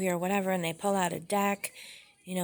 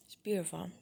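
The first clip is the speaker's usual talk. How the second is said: whisper; 7 dB quieter than usual. Real, not acted. Admiring the Ace card.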